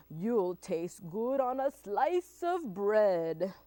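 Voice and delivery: slow, relishing monster voice; hungry Gruffalo. Acted. Gruffalo tells mouse he will eat him, relishing. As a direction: draw out the threat with appetite.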